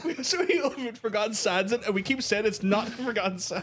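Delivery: high, laughing fed-up yell; real high exasperated laughing; laughing exasperated shout. Real, not acted. Exasperated at chat.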